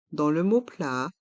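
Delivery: ordinary talk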